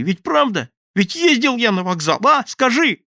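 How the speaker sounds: high, loud frightened babbling; panicked pleading. Acted. Terrified thief begs his accomplice to back him up. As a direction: beg them to believe you, voice climbing.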